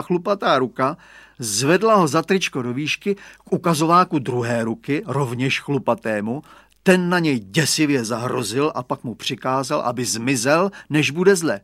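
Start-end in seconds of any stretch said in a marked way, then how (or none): none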